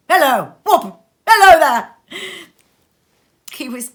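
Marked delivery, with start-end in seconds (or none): 0.1-1.9 loud, higher whooping call; gleeful shout. A narrator's reading. Scrooge calling out like a baby, loud and gleeful. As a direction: holler it out gleefully.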